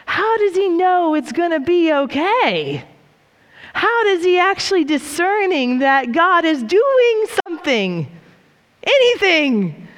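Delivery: high, incredulous questioning; can't believe he is so sure; baffled disbelief. Retold or imitated. I was like.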